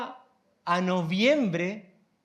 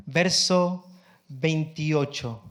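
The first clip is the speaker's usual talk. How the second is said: usual speaking voice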